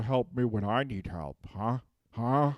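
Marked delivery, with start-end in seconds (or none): none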